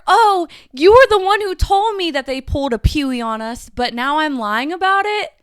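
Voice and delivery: loud, high incredulous mockery; sarcastic mock quote. Challenging a friend who called her a liar, loud incredulous mockery.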